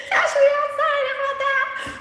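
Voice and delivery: high, laughing catchphrase impression; mock street-accent squeal, cracking up; Cash Me Outside girl. Host mimicking a viral girl's catchphrase, high laughing impression. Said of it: "doing the Cash Me Outside girl accent".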